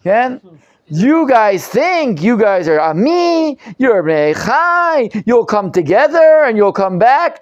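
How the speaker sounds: mocking. Teacher voicing the prophet mocking Am Yisrael, mocking. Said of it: "He's mocking Am Yisrael".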